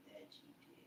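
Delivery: breathy whispered echo. Pretend. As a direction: whisper "That you did" with no voice at all. Echoing the line, two takes.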